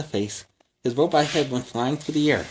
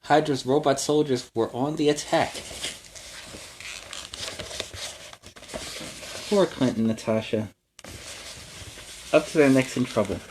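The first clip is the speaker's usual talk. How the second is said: put-on lively read-aloud for kids; storybook narration; 3 dB quieter than usual. A narrator's reading. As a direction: tell it like a bedtime adventure. Reads story to children.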